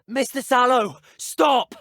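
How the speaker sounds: high, loud urgent call to halt; alarmed shout. Acted. Calling on Mister Sallow to stop, alarmed shout. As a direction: shout it sharply to stop someone.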